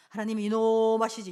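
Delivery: loud mock scolding of a child; mock scolding parent. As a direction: bark it like a parent threatening a kid. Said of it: "이렇게 가르치면 안 된다고".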